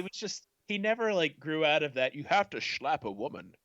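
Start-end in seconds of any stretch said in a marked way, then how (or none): 2.1-3.5 Scottish star impression with "sh" for s; Sean Connery. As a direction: slush the s sounds in a mock Scottish voice. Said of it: "he's just being Sean Connery".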